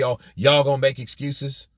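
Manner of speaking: ordinary talk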